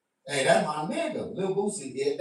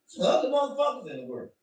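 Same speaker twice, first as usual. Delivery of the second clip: ordinary talk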